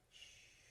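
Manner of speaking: soft whispered shh; wind shush. Shown as a demonstration. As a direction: hush like the wind. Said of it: "It whispers".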